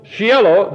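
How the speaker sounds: Shiloh read as SHEE-eh-loh; Hebrew-style Shiloh within a scripture reading; Hebrew pronunciation. Shown as a demonstration. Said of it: "you can read it this way".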